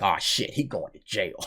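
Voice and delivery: low, hushed "oh no" reaction; imitated dismayed aside. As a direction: say it under your breath, sinking in pitch. Voicing the studio's resigned reaction.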